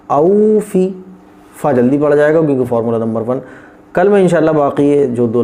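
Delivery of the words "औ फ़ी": औ फ़ी with औ held medium; medium-length औ. Shown as a demonstration. Teacher demonstrating the word for students. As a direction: say औ neither quick nor long. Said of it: "बीच में है".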